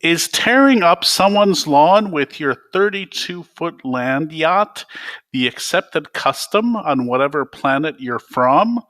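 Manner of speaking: mock the intruder with biting sarcasm; sarcastic scolding. Indignant narrator scolds limo driver.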